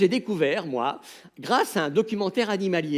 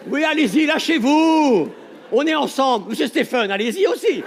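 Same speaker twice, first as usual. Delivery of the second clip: urging crowd; 5 semitones higher, 5 dB louder than usual. Real, not acted. A comedian pushes the audience to let loose, loud high cheer. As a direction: egg the crowd on.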